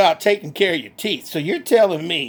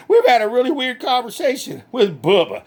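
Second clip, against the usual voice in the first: ordinary talk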